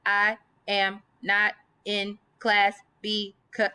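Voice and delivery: slow, pausing after every word; mocking a stop-start reading; slow comma-heavy read. Shown as a demonstration. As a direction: read it choppy, a pause between each word. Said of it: "you don't speak like that".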